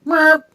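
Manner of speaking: high, loud comic horn beep; imitated horn. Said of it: "It was like".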